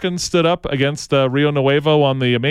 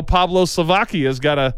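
normal talking voice

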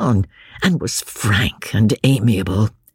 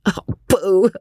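higher, laughing dismissal; 7 semitones higher than usual. A narrator's reading. James laughing at his own absurdity, amused. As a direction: pooh it away, laughing at yourself. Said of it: "laughing at his own absurdity".

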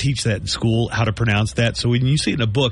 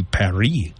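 Paris said "pah-REE", French-style, lower; native French pronunciation; 4 semitones lower than usual. Shown as a demonstration. Co-host showing how locals say it, French pronunciation. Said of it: "pronounce it like a native".

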